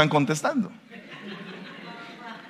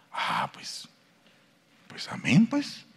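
a mumbled, half-hearted amen, much softer; the brother shrugs off the compliment, not convinced; unconvinced mumble; 10 semitones lower, 10 dB quieter, slower than usual. Retold or imitated. Brother accepting praise with an unconvinced, mumbled amen. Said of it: "no muy convencido".

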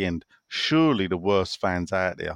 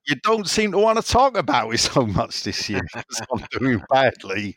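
exaggerated Somerset accent, pitched up; Doddsy's Somerset accent. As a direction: put on a Somerset burr. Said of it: "which he then says".

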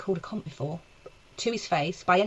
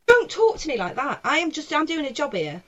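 raised-pitch, affronted objection; retold indignant protest; 7 semitones higher than usual. Comic telling the punter she's working, indignant protest. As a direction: push back as the wronged worker.

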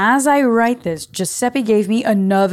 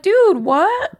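high-pitched astonished outburst; stunned, squeaky-high reaction; incredulous disbelief; 13 semitones higher, 3 dB louder than usual. Retold or imitated. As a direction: shoot the voice way up in amazed disbelief. Astonished reaction to free meal.

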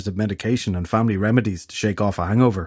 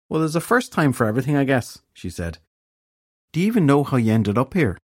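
higher, lightly lilted character voice; lilting fairy woman, unimpressed; 5 semitones higher than usual. A narrator's reading. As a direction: shrug it off with a Northern Irish lilt. Voices Bunty.

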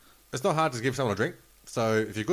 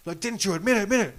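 furious yelling; 10 semitones higher than usual. Retold or imitated. Wife confronting her husband's mistress in the casino, furious high yell. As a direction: scream it at the woman who slept with your husband. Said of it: "this lady's yelling at her going".

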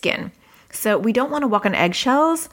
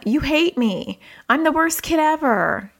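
put-on higher-pitched wail of a sensitive child; 7 semitones higher than usual. Sensitive child accusing a parent, hurt and higher-pitched. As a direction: play a hurt, sensitive kid. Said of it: "things like this from a sensitive child".

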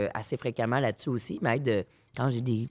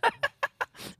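short laugh; 4 dB quieter than usual. Real, not acted. Laughing after the punchline.

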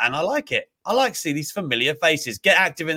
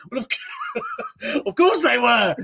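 real laugh breaking through the words; much slower than usual. Real, not acted. Mocking Hogan's story, laughing through the reply. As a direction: laugh it out in disbelief.

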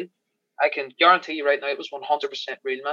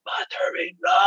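retold battle shout; 4 semitones higher, slower than usual. Extra shouting the line for the director, a mild mock yell. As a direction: relive the shout lightly. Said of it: "I really fucking went for it".

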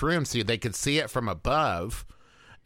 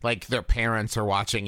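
normal talking voice

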